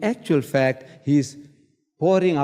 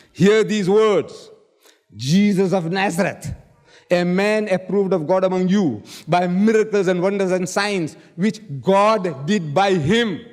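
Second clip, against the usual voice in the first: proclaiming; 3 semitones higher than usual. Acted. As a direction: proclaim it to a crowd with weight.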